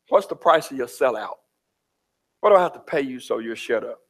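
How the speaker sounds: low, menacing whisper-like devil voice; Satan tempting. Acted. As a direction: play the devil luring a believer, low and sly. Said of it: "And the Satan wants to know".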